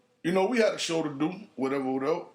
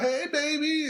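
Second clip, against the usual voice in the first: mock flirty woman; 9 semitones higher than usual. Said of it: "She like".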